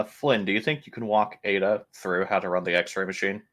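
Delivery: normal talking voice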